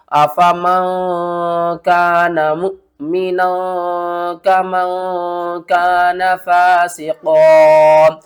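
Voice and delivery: very slow melodic recitation, pausing at the waqf; Quran recitation. Shown as a demonstration. Teacher recites to show the pause at waqf-e-ghufran. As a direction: recite slowly, stopping at the waqf sign. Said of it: "किस तरह?".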